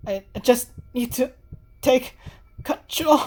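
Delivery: high, stammering resolve climbing up; shaky resolve. Acted. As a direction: talk yourself into it through fear. Senri voiced.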